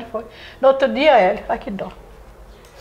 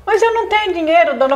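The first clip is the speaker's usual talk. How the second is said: imitando mãe sem dinheiro; 6 semitones higher than usual. Retold or imitated.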